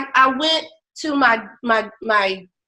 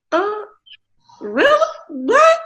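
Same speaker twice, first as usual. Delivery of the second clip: much higher, disbelieving; can't believe what she hears; incredulous; 11 semitones higher, slower than usual. Retold or imitated. Stunned by doctor's refusal.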